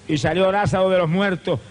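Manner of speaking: normal talking voice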